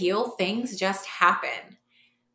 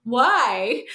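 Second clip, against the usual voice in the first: incredulous why; 6 semitones higher, 7 dB louder than usual. Retold or imitated. End of incredulous quote.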